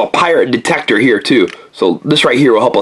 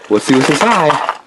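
ordinary talk, nothing marked

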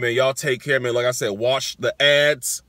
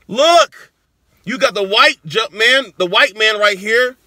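loud, high and thrilled, showing off the logo; excited shout; 9 semitones higher, 9 dB louder than usual. Real, not acted. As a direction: shout it out like you can't believe your luck. Excited shoe reveal.

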